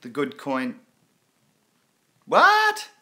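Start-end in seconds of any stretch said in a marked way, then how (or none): 2.3-2.9 much higher and louder sudden what; real startled question; surprised. Real, not acted. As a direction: caught off guard by the news. Surprised by news in chat.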